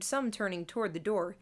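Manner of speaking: ordinary delivery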